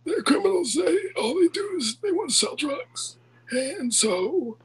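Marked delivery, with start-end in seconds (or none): 0.0-3.2 raised pitch, put-on Bill Clinton-ish voice; hammed-up impression of a politician; mock politician voice. Comedian rants as a politician in a put-on voice.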